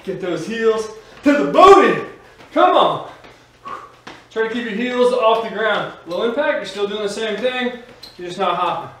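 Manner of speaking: loud upbeat workout coaching; aerobics instructor. Acted. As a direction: pump up the class, energetic. Fitness instructor pumping up class.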